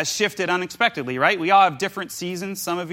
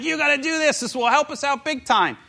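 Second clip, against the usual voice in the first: excited urging; 7 semitones higher than usual. Retold or imitated. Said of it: "And she's excited".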